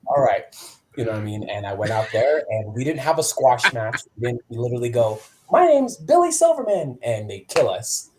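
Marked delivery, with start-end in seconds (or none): 5.5-7.0 high, loud self-announcement; hammed-up introduction. Wrestler introducing himself before a match, hammed-up.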